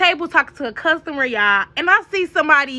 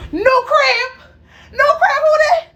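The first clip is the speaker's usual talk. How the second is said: high, loud, jubilant yell; gleeful shout; 13 semitones higher, 5 dB louder, slower than usual. Real, not acted. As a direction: shout it like a victory chant. Triumphant punchline of moving out.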